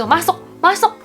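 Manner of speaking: a hint of a bossy boyfriend; pushy boyfriend. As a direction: push her into the car. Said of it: "Dia dorong aku gitu".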